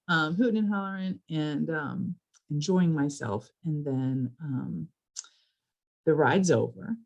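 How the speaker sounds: ordinary delivery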